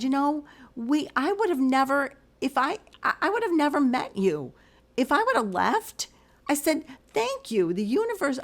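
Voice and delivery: high-pitched, emphatic retold gratitude; heartfelt amazement. Gym-goer thanking the stranger she nearly missed, heartfelt amazement. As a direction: pitch lifted, marvelling at a chance meeting.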